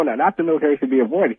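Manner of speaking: normal talking voice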